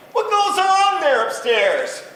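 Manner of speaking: high, loud, slow moose asking; puzzled moose. Acted. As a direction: moose wondering about noises. Moose asks guests.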